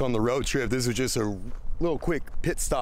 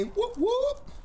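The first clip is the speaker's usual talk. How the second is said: high-pitched whooping call; excited whoop. Real, not acted. Calling out with Arrow.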